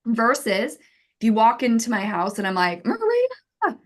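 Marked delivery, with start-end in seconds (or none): 2.8-3.8 high squeal on the name, then gushing; excited greeting. Acted. Host welcoming Maria into the house, excited squeal. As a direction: greet a friend with acted excitement. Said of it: "I'm so excited".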